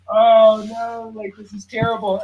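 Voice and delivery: high, loud "oh" of comic dismay; mock dismay. Customer reacting to being answered in English, mock dismay.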